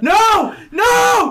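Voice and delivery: high, loud desperate yell; anguished scream. Retold or imitated. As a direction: scream as she is about to die. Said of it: "And he's like".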